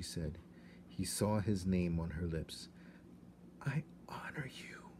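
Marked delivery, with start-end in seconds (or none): 3.6-4.9 soft, breathless whisper. A narrator's reading. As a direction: breathe it secretly. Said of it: "whispered Despereaux".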